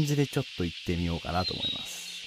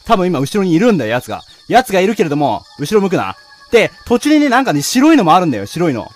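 tense loud urgent; 5 semitones higher, 11 dB louder than usual. Real, not acted. Tense while chased.

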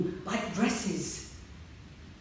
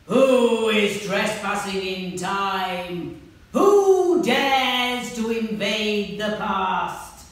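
slow booming dragon, much louder; 10 dB louder, much slower than usual. Acted. Dragon challenging the children, slow booming roar.

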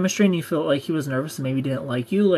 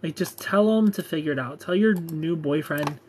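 normal talking voice

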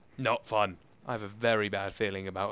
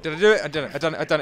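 rising excited repeat; louder, thrilled check; 3 semitones higher, 8 dB louder, much faster than usual. Real, not acted. Gamer asking the chat, excitedly thrilled.

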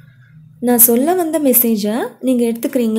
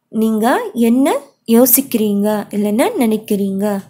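ordinary delivery

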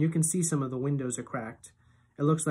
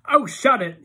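high, loud snap; Iggy telling Loretta to hush; Iggy snapping; 8 semitones higher, 10 dB louder than usual. A narrator's reading. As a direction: cut her off.

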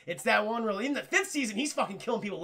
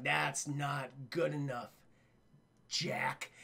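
low menacing voice; 6 semitones lower, 6 dB quieter, much slower than usual. Retold or imitated. Villain voicing retold scene.

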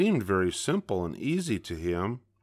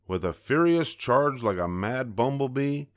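usual speaking voice

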